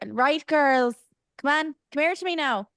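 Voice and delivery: high, coaxing Irish lilt; Irish accent. Shown as a demonstration. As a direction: call the girlies in with an Irish lilt. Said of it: "that's an Irishism".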